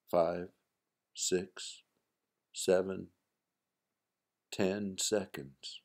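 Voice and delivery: ordinary delivery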